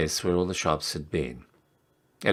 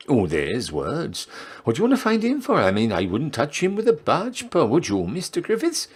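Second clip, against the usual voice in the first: chatty barmaid; 11 semitones higher than usual. Acted. Barmaid gossip about monkey man.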